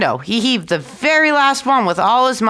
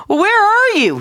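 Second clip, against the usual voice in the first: puzzled call; 11 semitones higher, 3 dB louder, slower than usual. A narrator's reading. Innkeeper asks unseen voice.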